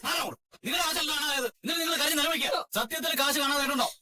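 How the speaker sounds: high, heated accusing voice; angry confrontation. Acted. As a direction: call out her fake tears, furious. Son angrily exposing his mother's drama. (ദേഷ്യത്തോടെ കുറ്റപ്പെടുത്തി)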